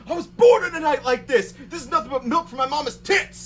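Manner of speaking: high, loud wild proclamation; loud crazed man declaring into the microphone; unhinged beach guy. Retold or imitated. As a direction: bellow it like a madman in a storm. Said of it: "the guy grabs the microphone and goes".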